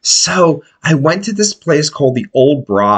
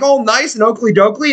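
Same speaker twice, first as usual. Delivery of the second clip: ordinary talk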